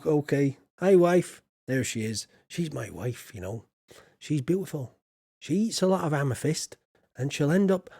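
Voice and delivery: ordinary talk, nothing marked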